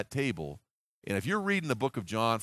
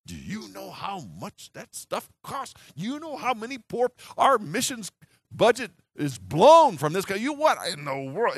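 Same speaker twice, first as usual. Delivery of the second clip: indignant Judas; 7 semitones higher, 7 dB louder than usual. Acted. Judas scolding the disciples about the perfume, higher and outraged. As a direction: pitch jumps high, outraged at the cost. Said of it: "Judas is fit to be tied".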